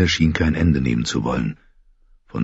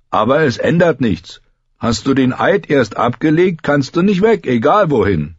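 high, insistent argument; emphatic boyish character voice; firm young Grenn; 9 semitones higher than usual. A narrator's reading. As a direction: lay down the rule in a raised voice. Grenn holds John to oath.

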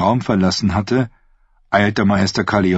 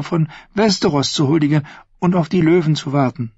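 put-on young woman's voice set higher than the narration, sweetly scheming; Thyene's voice; 10 semitones higher than usual. Thyene to her uncle the prince, sweetly scheming. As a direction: speak as the sly, innocent-sounding niece.

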